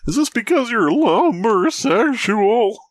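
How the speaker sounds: high, slow cartoon dad voice; hammed-up dopey cartoon question; Goofy. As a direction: drag it out in a silly high voice.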